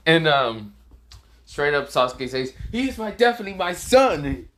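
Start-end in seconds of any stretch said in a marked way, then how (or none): 2.7-4.5 high-pitched gushing outburst; excited claim. Acted. Sasuke claiming fearless Boruto as his son, excited. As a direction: blurt it out high, overjoyed.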